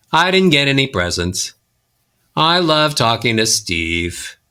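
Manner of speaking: sinking descending scale with long pauses; sad, bored. Shown as a demonstration. Coach demonstrating descending scales, sad and bored. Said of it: "make you sound sad and bored".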